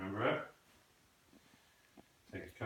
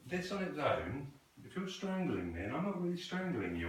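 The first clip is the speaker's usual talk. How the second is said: ordinary talk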